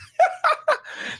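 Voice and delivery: very high shrill cackling laugh; piercing laugh. Real, not acted. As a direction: laugh sharply, cutting through the room. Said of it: "That laugh goes through you".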